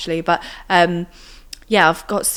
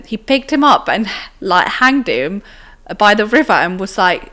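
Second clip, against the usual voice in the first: usual speaking voice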